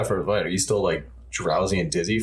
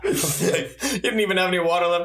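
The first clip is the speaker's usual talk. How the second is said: laughing in disbelief, drawn out; high-pitched laughing commiseration; 8 semitones higher than usual. Real, not acted. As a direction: talk through a high laugh. Laughing at the groin-kick story.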